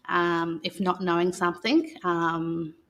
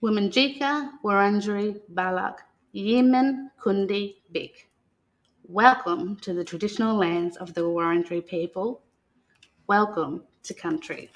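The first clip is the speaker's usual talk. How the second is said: put-on reading to children. [name] reads the Welcome to Country book to the children, in a calm storytelling voice. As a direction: read it aloud like a welcome ceremony to young listeners.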